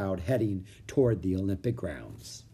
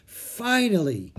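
put-on higher, drawn-out girl's voice; Annie's voice; 8 semitones higher, 3 dB louder, much slower than usual. A narrator's reading. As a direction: stretch it out, pleased. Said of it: "she said".

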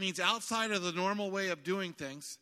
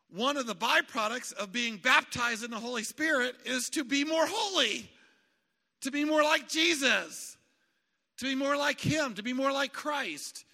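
higher, louder sermon build; preaching at full force; 10 semitones higher, 7 dB louder than usual. Real, not acted. Preacher urging the congregation, at full force. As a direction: lift each "more like" phrase like a rising appeal.

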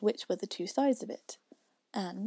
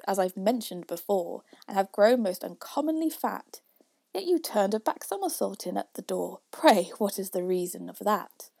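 louder, rhythmic verse; poem recitation; 6 dB louder than usual. A narrator's reading. Youth questions Father William, in loud recitation. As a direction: recite it out with a firm beat.

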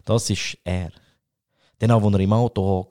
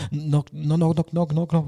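higher-pitched imitation of nervous stammering; 7 semitones higher than usual. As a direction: act out someone nervously fumbling a knock-knock joke. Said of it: "so voll nervös und so". (nervös nachgeahmt)

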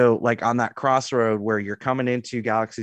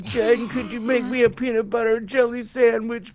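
mocking a whiny sick husband, much higher; whiny sick man; 10 semitones higher than usual. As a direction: imitate the helpless husband begging. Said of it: "And then he was like".

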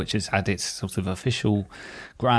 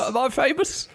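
high, giddy mock disbelief; mock-thrilled; 14 semitones higher than usual. Real, not acted. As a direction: squeak it like a star-struck fan. Thrilled at being cited.

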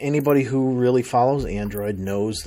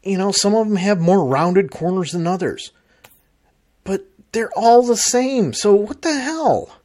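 exasperated rant; 8 semitones higher, 4 dB louder than usual. Real, not acted. Complaining rant about identical phones.

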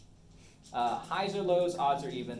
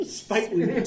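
laughing; 4 semitones higher, 6 dB louder than usual. Real, not acted. Laughing repeat of the name.

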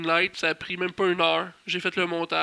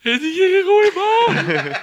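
high, loud, drawn-out wail; re-enacted crying; 12 semitones higher, 7 dB louder, much slower than usual. Host telling mother a wrestler died, hammed-up tearful wail. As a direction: wail the news in hammed-up grief. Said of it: "j'ai vraiment pleuré sur Eddie Guerrero".

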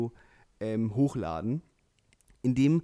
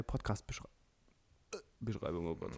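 choking; 5 semitones lower, 10 dB quieter than usual. Real, not acted. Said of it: "ich hab mich grad verschluckt".

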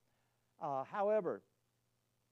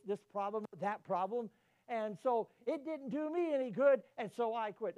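raised, aggrieved voice of a disillusioned believer; complaining churchgoer; 5 semitones higher, 7 dB louder than usual. Retold or imitated. Preacher quoting a churchgoer, aggrieved and loud. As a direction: lay out the grievance, building in pitch and volume.

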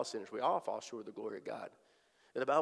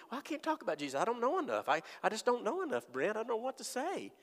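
higher, whining excuse voice; whiny excuse; 9 semitones higher than usual. Retold or imitated. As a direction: play a nervous believer making excuses. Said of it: "I hear a lot of people".

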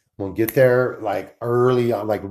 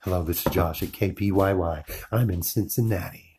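low, smooth radio DJ voice; deep chesty announcer read; 4 semitones lower, 6 dB quieter than usual. Pretend. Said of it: "Should I do my radio voice?".

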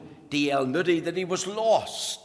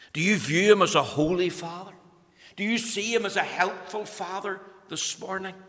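ordinary talk, nothing marked

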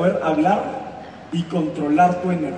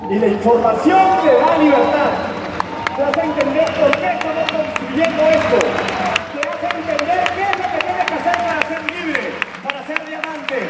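rousing pitch; 8 semitones higher than usual. Real, not acted. Speaker urges the audience toward freedom, rousing. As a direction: sell the vision with energy.

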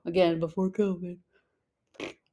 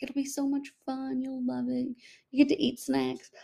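put-on coaxing a child; 6 semitones higher, 5 dB quieter than usual. Coaxing little Rosie about school.

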